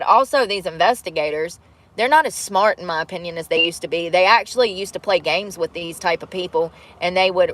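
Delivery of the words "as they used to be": usual speaking voice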